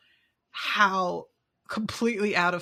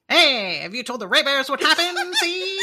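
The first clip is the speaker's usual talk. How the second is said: hammy crocodile character, pitched up; louder, higher put-on crocodile voice; 4 semitones higher, 5 dB louder than usual. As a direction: play a pushy cartoon crocodile. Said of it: "I could do the crocodile as like".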